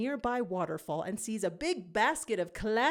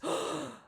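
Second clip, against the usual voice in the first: sharp little gasp; surprised gasp; 8 semitones higher than usual. A narrator's reading. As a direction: gasp in recognition. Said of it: "He gasps".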